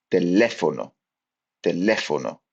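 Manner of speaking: Teléfono with the stress on the second syllable, te-LÉ-fo-no. Shown as a demonstration. Teacher showing students where the stress falls. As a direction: lean on the LÉ in Teléfono. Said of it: "indicate stress in a word".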